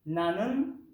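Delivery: usual speaking voice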